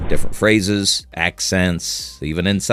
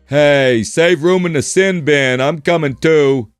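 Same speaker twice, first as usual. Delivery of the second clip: louder, higher ribbing shout; teasing call-out; 6 semitones higher, 4 dB louder than usual. Acted. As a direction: rib your teammate across the box. Teasing teammate in penalty box.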